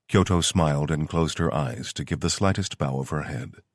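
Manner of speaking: usual speaking voice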